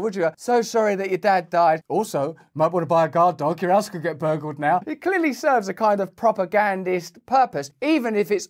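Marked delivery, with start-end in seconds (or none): 0.4-4.8 mock condolence. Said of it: "You'd never do that to an individual".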